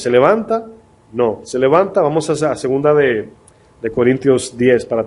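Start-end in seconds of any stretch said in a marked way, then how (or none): 0.0-0.7 high rhetorical question. Shown as a demonstration. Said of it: "Y es una batalla cada vez".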